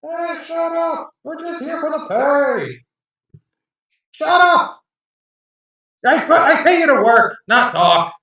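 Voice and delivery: high, loud yelling from the crew; rowdy sailors shouting. Acted. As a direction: bark it across the deck. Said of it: "the men in the back of the boat saying".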